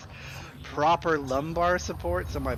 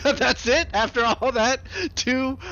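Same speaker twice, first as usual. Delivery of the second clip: incredulous; 11 semitones higher, 7 dB louder, slower than usual. Real, not acted. Reacts to disappointing result.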